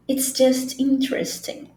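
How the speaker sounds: "interesting" as in-tres-ting within the phrase; silent middle E. Shown as a demonstration. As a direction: model the phrase with "interesting" in three syllables. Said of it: "esse é aqui é mudo".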